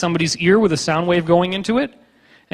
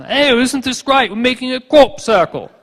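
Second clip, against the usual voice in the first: put-on a drunk guy cheering, pitched up and slowed down; 7 semitones higher, 4 dB louder than usual. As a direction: play a tipsy guy proud of his prank. Drunk guys making crop circles.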